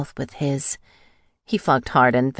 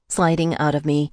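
ordinary delivery, nothing marked

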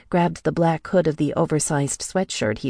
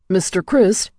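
high, loud call across the hall; 5 semitones higher, 5 dB louder than usual. A narrator's reading. Attendant calls after Michael in the club hallway. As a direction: call out to catch his attention. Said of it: "a male voice called".

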